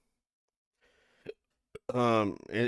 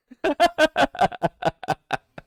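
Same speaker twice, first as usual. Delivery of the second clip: high burst of laughter; 5 dB louder than usual. Real, not acted. Laughing at the publicity stunt idea.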